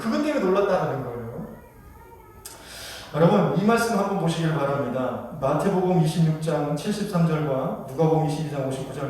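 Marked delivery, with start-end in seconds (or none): none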